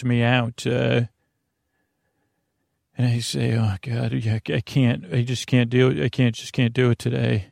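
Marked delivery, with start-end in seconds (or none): none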